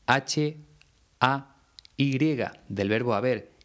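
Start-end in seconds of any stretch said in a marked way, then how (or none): 0.1-2.2 each letter of "H A Y" named clearly and apart; deliberate spelling of "H A Y"; spelled letter by letter. Shown as a demonstration. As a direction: spell out "H A Y" one letter at a time. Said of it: "la forma verbal hay".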